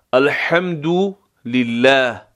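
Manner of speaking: الحمدلله with ḥ from the middle of the throat, said slowly; throaty ḥ. Shown as a demonstration. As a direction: stretch الحمدلله and push the ḥ from the throat. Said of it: "ها جیمی از وسط حلق".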